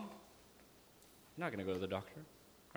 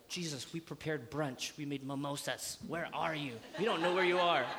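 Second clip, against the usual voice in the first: put-on fussy disciples. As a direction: play the worried brunch hosts. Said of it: "everybody, they're like".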